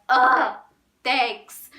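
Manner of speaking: loud embarrassed "Ugh, thanks"; flustered groan. Retold or imitated. Fan flustered by compliments, loud embarrassed groan. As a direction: blurt it out, all flustered.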